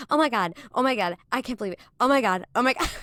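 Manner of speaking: gushing, hammed-up overexcitement; mock freak-out. Co-host reenacting her friend's starstruck texts, mock freak-out. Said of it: "I remember the text Daniela was sending".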